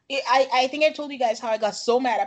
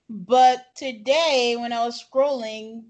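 usual speaking voice

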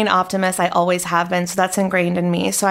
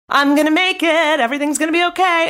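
much higher, fast, hammed-up cheery denial; mock forced positivity; 8 semitones higher than usual. Mocking someone who suppresses feelings, much higher and sing-song. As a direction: chirp it like a fake-happy pep talk. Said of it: "just go".

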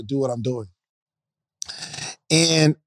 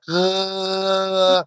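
imitating a baby's gargle grunt; a toddler's little copycat grunt; baby gargle sound; 4 semitones higher, 3 dB quieter than usual. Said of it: "my baby's like".

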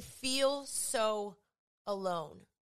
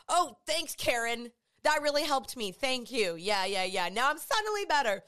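louder, overplayed sarcasm at Karen; sarcastic thanks; 5 dB louder than usual. Mocking a friend who said stop being sad, loud sarcasm. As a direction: thank her loudly, meaning the opposite.

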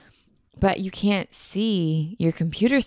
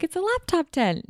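much higher, chirpy mom voice; mom announcing; 12 semitones higher than usual. Retold or imitated. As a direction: play mom proudly presenting the gift. Mom names the gift.

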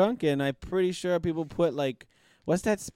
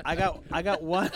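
normal talking voice

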